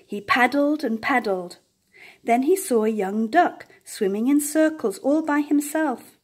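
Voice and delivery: plain reading voice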